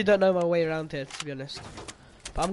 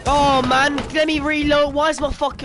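frustrated outburst; 7 semitones higher, 5 dB louder than usual. Real, not acted. Raging at the game.

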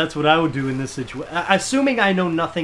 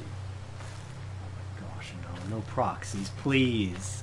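fearful whisper; 11 semitones lower, 13 dB quieter, much slower than usual. Real, not acted. Dread of proxy level.

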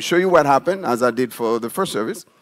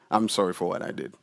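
softer, lower apology; calm apology; 6 semitones lower, 9 dB quieter, faster than usual. Shown as a demonstration. Preacher acting the calm apology, softer. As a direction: say it gently, deflated. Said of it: "So now I'm calm".

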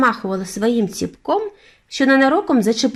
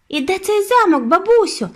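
put-on impatient boy, much higher and louder; impatient exclaim; 11 semitones higher, 4 dB louder than usual. A narrator's reading. Boy pressing his grandmother about the castle, impatient. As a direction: blurt it out, can't wait. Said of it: "нетерпляче вигукнув я".

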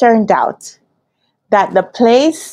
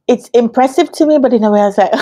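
ordinary talk, nothing marked

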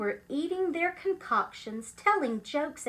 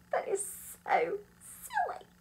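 silly high voice; 9 semitones higher than usual. A narrator's reading. Giggly aside in high voice.

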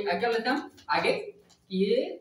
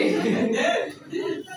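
loud, high burst of laughter; high-pitched cackling laugh; 8 semitones higher, 4 dB louder than usual. Real, not acted. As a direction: crack up at your own joke. Laughs at his own ox joke.

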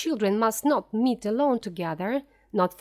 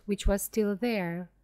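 usual speaking voice

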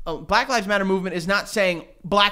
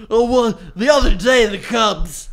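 loud impression of a sports announcer; Harry Caray; 2 semitones higher, 6 dB louder than usual. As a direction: boom it out as a caricatured baseball commentator. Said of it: "Harry Caray?".

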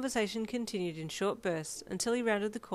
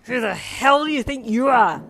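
loud, high angry shout; drunken hollering; 6 semitones higher, 15 dB louder than usual. Acted. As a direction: holler like a drunk, furious dad. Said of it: "your dad hollered drunkenly".

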